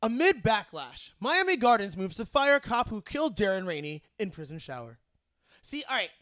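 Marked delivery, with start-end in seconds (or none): 0.0-5.0 punchy, louder news anchor read; harder newscaster voice. Shown as a demonstration. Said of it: "We gotta go harder".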